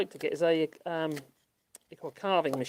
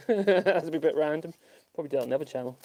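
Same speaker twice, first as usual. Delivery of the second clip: usual speaking voice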